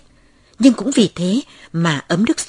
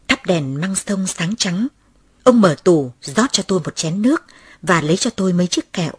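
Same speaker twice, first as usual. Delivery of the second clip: plain reading voice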